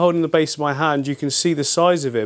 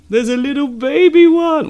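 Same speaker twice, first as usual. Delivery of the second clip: high, loud delighted squeal; excited reveal; 13 semitones higher, 9 dB louder than usual. Real, not acted. Vlogger showing viewers the baby fish, squealing with excitement. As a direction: burst out high and loud at a cute find.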